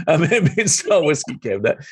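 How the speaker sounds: amused, proud repeat of "Mad Men style whiskey cabinet"; laughing repeat. Real, not acted. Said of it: "I was very proud of that cabinet".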